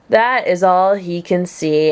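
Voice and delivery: usual speaking voice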